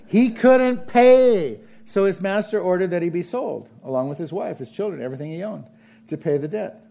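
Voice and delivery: loud, high, forceful scripture reading; fiery sermon reading; preaching scripture. Acted. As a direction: proclaim the verse to the room.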